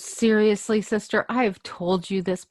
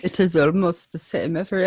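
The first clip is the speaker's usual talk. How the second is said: put-on posh accent; 4 semitones higher than usual. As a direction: greet them in a hammed-up posh accent. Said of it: "that's a lovely accent".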